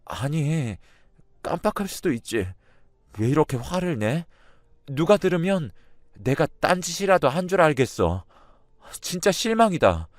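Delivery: high, tearful voice, close to crying; tearful protest. A narrator's reading. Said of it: "울먹이면서".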